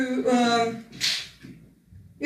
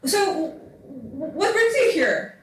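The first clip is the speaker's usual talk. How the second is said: put-on higher, curious French-Russian accented elf; elven woman asking; 6 semitones higher than usual. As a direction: lift it up, stammer into the question. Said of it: "she's like".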